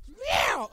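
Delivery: high squeaky mock meow; a loud cartoon cat cry. Imitating the cat again.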